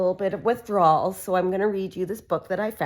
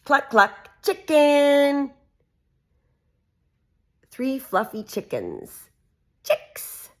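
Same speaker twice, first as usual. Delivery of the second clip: lively sing-song picture-book reading; reading to children; 3 dB louder, much slower than usual. Acted. Mum reading a farm book to her kids, animated. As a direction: read it out brightly for little ones.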